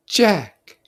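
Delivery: high, earnest schoolboy voice, slowing to insist; a fussy boy pleading for fairness; Piggy's voice. Acted. Piggy names Jack.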